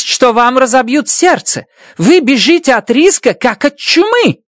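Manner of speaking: high, scornful tirade; angry accusing woman. Acted. Stratt scorns Grace as a coward, heated. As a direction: lash him with contempt. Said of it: "заорала она".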